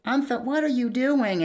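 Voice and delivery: lightly voiced curious dragon; Fountain. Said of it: "She goes".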